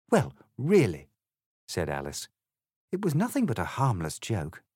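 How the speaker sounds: polite Alice, lightly voiced. Alice politely answers the egg, lightly voiced girl. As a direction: explain it courteously, a proper little lady.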